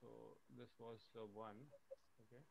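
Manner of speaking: faint voice, weak mic; words fading into silence; barely audible. Real, not acted. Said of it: "your audio is not audible".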